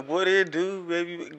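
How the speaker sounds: mimicking words through teeth; mock it through clenched teeth; through gritted teeth. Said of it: "You was talking through your teeth".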